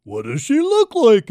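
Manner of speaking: a hint of a pig voice. A narrator's reading. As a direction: ask like a curious farm pig. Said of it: "asked the pig in the pen".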